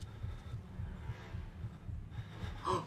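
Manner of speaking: breathy frightened gasp; sharp gasp. Real, not acted. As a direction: gasp in fear. Monster returns.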